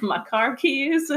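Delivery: high, drawn-out northern accent; lightly put-on New England accent. Shown as a demonstration. Sister asks for the family accent, northern accent demo. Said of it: "Which one do you want?".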